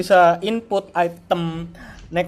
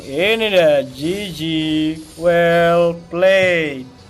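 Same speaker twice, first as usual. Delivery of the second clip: fighting game announcer; 2 semitones higher, 9 dB louder than usual. Real, not acted. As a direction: call the kills like a fight announcer. Hyped teamfight calls, wipe out.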